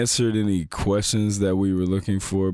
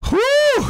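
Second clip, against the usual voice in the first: excited whoop; 7 dB louder than usual. Real, not acted. Hyping the finale.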